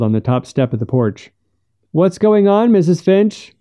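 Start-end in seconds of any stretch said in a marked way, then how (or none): 1.9-3.5 high, raised call across the yard; shouting. A narrator's reading. Monterey calling to Missus Finch from the porch, shouting. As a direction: call out a question, pitched well up. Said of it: "he shouted at her".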